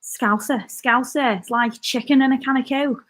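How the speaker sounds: playful Scouse with throaty k sounds; Scouse accent. Shown as a demonstration. Streamer trying a requested accent for viewers, Scouse accent. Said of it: "Scouse or Brummie?".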